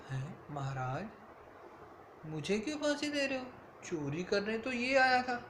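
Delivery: high, disbelieving cry of "why me"; shocked protest. Acted. The poor man protests to the king, shocked and high-pitched. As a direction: plead in stunned disbelief.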